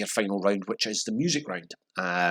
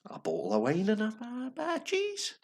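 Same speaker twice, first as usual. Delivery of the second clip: silly high voice. Pretend. Host finishing the Boris joke in a daft high voice. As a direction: stretch it out in a daft high squeak. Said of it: "Why did I say it that way?".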